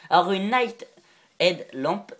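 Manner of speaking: "Night Head Lamp" said with a heavy French accent; clumsy French-accented English brand name. Real, not acted. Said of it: "merci pour mon accent".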